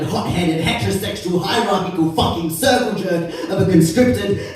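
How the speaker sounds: furious tirade. Acted. Poet raging at the government over war spending, loud and furious. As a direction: spit the words out at the government.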